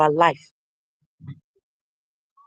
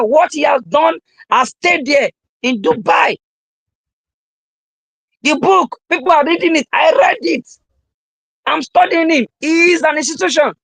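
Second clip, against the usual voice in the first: fired-up preaching; 5 semitones higher than usual. Acted. Speaker preaching to live viewers about Dubai, fired-up and raised.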